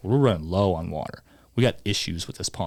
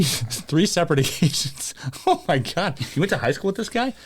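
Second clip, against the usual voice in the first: laughing; 8 semitones higher than usual. Real, not acted. Laughing at the article.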